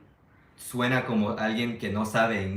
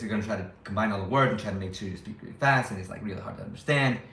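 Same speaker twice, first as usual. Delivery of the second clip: rapid, words combined into one stream; fast, run-together speech; faster than usual. Shown as a demonstration. Teacher showing how people in the States talk, fast and run together. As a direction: rush it so it's hard to follow. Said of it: "they're gonna speak like this".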